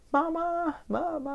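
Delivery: put-on high wailing child's cry; child crying for mama. Retold child sobbing for her mother. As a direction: wail for mama like a desperate child. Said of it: "hon är ju helt förtvivlad".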